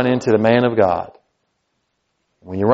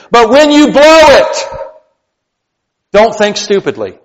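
loud, much higher, driving preaching; full-force preaching; 9 semitones higher, 7 dB louder than usual. Acted. Pastor urging the church to take decisions seriously, loud preaching. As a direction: hammer it home to the congregation.

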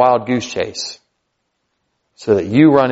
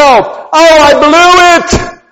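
mock despair, much higher and drawn out slowly; despairing wail; 9 dB louder, much slower than usual. Pastor mocking a believer who thinks all is lost, hammed-up wail. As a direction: ham up the hopeless cry. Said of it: "I don't want you to go".

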